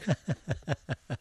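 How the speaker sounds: soft breathy chuckle trailing off; a quiet laugh at the end of the story; real laugh. Real, not acted. Ending his Tiger story with a soft real laugh.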